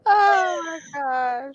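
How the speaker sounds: slow, high wheezing laugh-cry; real helpless laughter. Real, not acted. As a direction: laugh so hard it breaks. Laughing till crying.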